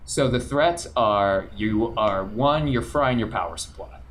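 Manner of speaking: usual speaking voice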